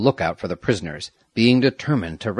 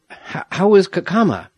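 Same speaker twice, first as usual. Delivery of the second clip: high question with a stammered start; anxious, raised-pitch asking after coming round; faltering question; 9 semitones higher, 5 dB louder than usual. A narrator's reading. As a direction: stumble on the first word, then ask urgently. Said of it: "he asked as soon as he could speak".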